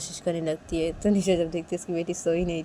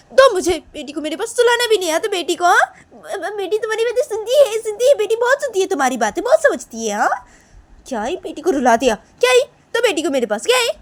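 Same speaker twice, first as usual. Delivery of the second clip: high, loud, heated telling-off; worked-up quarrelling voice; angry reproach; 12 semitones higher, 11 dB louder than usual. Acted. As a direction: demand the baby back indignantly. Acted quarrel over crying baby.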